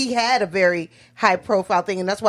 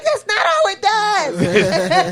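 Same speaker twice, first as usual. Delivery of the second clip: mock girly teen voice; 12 semitones higher, 3 dB louder, much slower than usual. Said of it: "And she's like".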